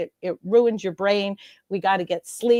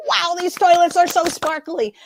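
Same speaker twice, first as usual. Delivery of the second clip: high, loud, gushing praise; gushing delight; 8 semitones higher, 5 dB louder than usual. Retold or imitated. Guest replays praising a restroom cleaner, loud and gushing. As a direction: rave about the toilets like a thrilled fan. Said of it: "and I go".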